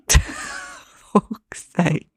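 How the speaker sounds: high laugh into exasperated curse. Real, not acted. Laughs at hiccup fit.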